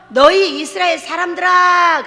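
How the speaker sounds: slow, loud, solemn call to the people; booming proclamation. Acted. Preacher booming a prophet's call to Israel, slow and loud. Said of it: "제가 변해서".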